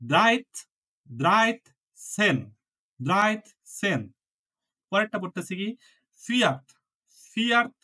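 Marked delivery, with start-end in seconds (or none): 0.1-4.0 highlight the t in ড্রাইট সেন, slow and clear; pronunciation demo. Shown as a demonstration. Teacher reading aloud for the class, pronunciation demo. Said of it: "আমি পড়তেছি দেখেন". 6.2-7.7 ফিয়াট with a clear final t, higher; pronunciation demo. Shown as a demonstration. Teacher modelling the number for students, pronunciation demo. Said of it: "ত নিচ্ছি, ছ দিয়ে উচ্চারণ করছি".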